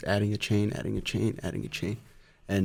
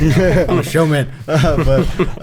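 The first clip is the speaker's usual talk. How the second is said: laughing; 7 semitones higher, 8 dB louder, slower than usual. Real, not acted. Laughing self-description.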